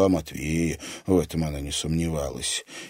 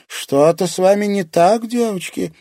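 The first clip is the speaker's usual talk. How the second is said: put-on higher, louder puzzled old woman; a hint of an old fortune-teller; 12 semitones higher, 7 dB louder than usual. A narrator's reading.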